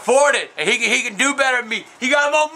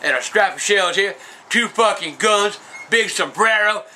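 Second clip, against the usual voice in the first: normal talking voice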